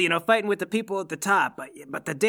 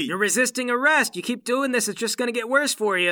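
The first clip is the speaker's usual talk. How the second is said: raised-pitch warning in a struggle; stern warning; 6 semitones higher than usual. Acted. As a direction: warn the suspect while holding him down. Hero warns a pinned suspect.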